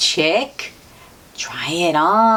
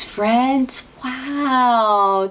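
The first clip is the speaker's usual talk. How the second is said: ordinary delivery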